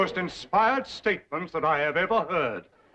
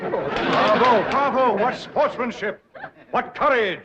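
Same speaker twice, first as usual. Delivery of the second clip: high, loud bravos; effusive praise; 7 semitones higher, 4 dB louder, slower than usual. Acted. Banker hails his colleague's sportsmanship, effusively. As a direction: gush with admiration. Banker cheers an employee's nerve.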